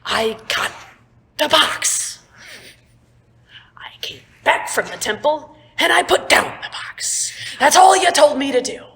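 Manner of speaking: high, loud, frantic ranting; exasperated rant. Acted. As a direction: rant at him, losing patience. Davin rants at Joe.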